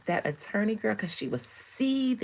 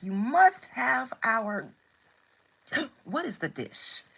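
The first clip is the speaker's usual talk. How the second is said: louder, slow, insistent mock Russian accent; imitated Russians; 3 semitones higher, 4 dB louder, slower than usual. Russians urging a visitor to eat, in an insistent mock Russian accent. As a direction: press the guest in a put-on Russian accent. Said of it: "all the Russians were like".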